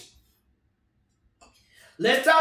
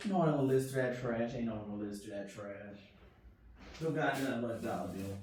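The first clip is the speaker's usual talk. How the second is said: much lower, softer and fast; deep muttering voice; 11 semitones lower, 14 dB quieter, faster than usual. Pretend. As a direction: mutter it deep and quick under the breath. Low muttered voice.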